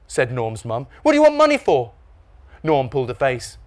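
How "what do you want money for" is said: high, loud mum voice; questioning mum. A narrator's reading. Mum questioning Norm about money, suspicious. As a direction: demand a reason in high pitch.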